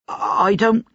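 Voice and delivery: high, scared protest cut off; frightened objection. A narrator's reading. Laundress begins to refuse her new master, frightened. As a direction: start a high, fearful objection.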